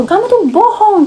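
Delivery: high, drawn-out mock-angry accusation; retold furious accusation. Host mother calling out a lie, mock-furious accusing. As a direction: imitate outrage, pitch leaping up, words stretched. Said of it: "Terus mama langsung ngamuk".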